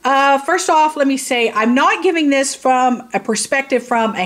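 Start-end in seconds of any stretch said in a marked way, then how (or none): none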